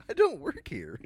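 high, loud "I don't work here" while laughing; laughing retort. Retold or imitated. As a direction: blurt it out mid-laugh. Laughing, retelling own reply.

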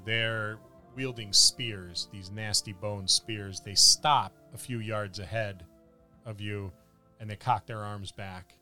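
normal talking voice

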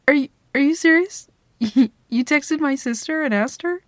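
high, loud, amazed; delighted surprise; touched disbelief. Acted. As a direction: gasp it out, genuinely touched. Learns he asked her sister.

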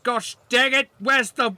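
high, loud, slow bossy yell; bossy high shout. Acted. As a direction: order the servant around. Character shouting at servant.

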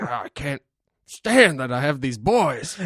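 lightly voiced exasperated father; a hint of a complaining dad; grumpy old dad. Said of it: "he's like".

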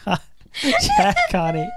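slow words through laughter; laughing. Real, not acted. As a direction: laugh while you say it. Laughing over the character.

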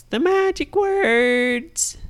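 slow, high, knowing question; teasing bunny. Acted. Bunny asks the kids for the magic words, slow and teasing. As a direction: stretch it out like a playful rabbit.

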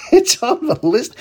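high-pitched, amused burst through a laugh. Real, not acted. Radio host chuckling to listeners over a growing travel list, laughing. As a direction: laugh as you repeat it. Laughs while repeating own remark.

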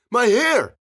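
loud, high-pitched wail; crying out. A narrator's reading. Johnson pops up with his head steaming, loud crying out. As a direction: wail it out in distress.

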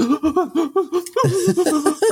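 high, stuttering crybaby sobs; mock sob in little hiccup gasps; imitated hiccupy sobbing. Boy can't stop crying before his crush, imitated hiccup sobs. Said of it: "in the hiccup stage".

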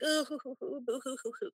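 high, hammed-up boo-hoo blubbering; mock sobbing. Imitating the drunk guy at the bar, hammed-up fake sobbing. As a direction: fake the crocodile tears, mocking them. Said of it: "he's, like, crying".

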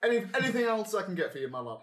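put-on northern accent. GM voicing barkeep.

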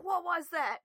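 imitated freaking out. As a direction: act out someone panicking in the dark. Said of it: "you just hear him freaking out".

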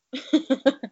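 high giggling laugh; burst of laughter. Real, not acted. Co-host cracking up at her own gutter line, laughing.

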